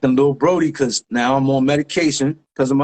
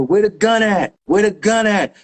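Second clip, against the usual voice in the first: higher, urgent repeated demand; imitated cop demand; 6 semitones higher than usual. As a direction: act out a cop demanding answers. Retold cop demands the gun.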